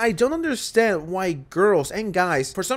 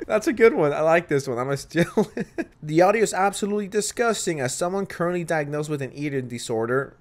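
normal talking voice

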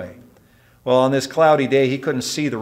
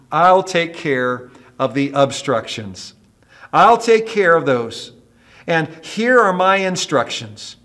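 stern, raised, emphatic command; Jesus; 4 semitones higher, 5 dB louder than usual. Acted. Jesus telling worried believers to obey and trust, stern. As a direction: instruct firmly, building to a loud, drawn-out promise. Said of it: "Jesus turns to us and says sternly".

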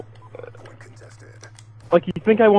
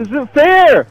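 higher and higher, ending in a yell; frustrated protest; 6 semitones higher than usual. Real, not acted. Player protesting an unfair opponent, indignant yell.

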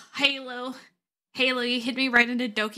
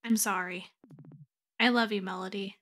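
subdued resignation; 2 semitones lower, 4 dB quieter than usual. Acted. Acted partner gives in and leaves.

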